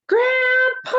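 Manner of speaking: high, loud call across the house; child calling out high and loud; yelling for Grandpa. Acted. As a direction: shout for Grandpa to come. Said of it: "So now he's yelling".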